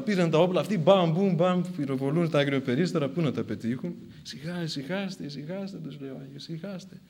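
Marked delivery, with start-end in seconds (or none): 4.2-5.8 very soft, hushed calming; soothing. Acted. Saint to soldiers firing at pigeons, soothing. 6.5-7.0 very soft, hushed calming; soothing. Acted. Saint to soldiers firing at pigeons, soothing.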